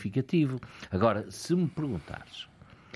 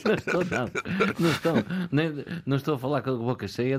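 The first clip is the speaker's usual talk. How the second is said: laughing; 7 semitones higher, 3 dB louder, faster than usual. Real, not acted. Laughing denial of talking with food.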